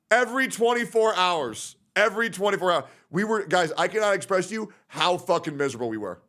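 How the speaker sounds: ordinary talk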